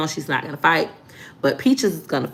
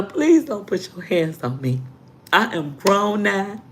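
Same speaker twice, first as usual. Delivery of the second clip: normal talking voice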